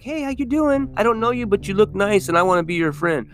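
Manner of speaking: put-on high, sweet little kid; innocent kid voice. Child greeting a stranger at the window, high and sweet. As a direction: pitch up like an open little boy. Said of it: "It's like".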